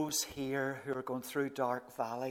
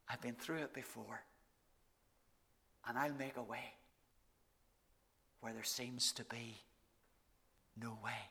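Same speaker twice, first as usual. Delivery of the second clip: soft and low, tender murmur; hushed reassurance; 4 semitones lower, 10 dB quieter than usual. Retold or imitated. Jesus comforting listeners facing a hard week, hushed murmur. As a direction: lean in and calm them quietly. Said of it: "what he whispers in our ear".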